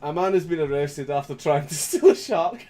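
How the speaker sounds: reading through giggles, then giving up; cracking up reading. Real, not acted. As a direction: try to read the news and break into laughter. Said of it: "I can't even make it through the first fucking sentence".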